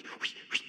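breathy whooshing swish of a switch. Retold or imitated. Pastor mimics the plum switch swishing, voiceless whoosh. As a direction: blow out a swish like a switch cutting air.

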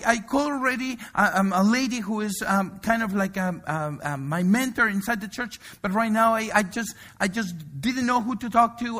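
imitating a flustered young caller; frantic apology. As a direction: stammer the apology in a rush. Frantic friend pours out her trouble.

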